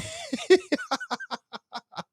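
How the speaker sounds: big high laugh; hearty laugh. Real, not acted. Host laughing at his kids.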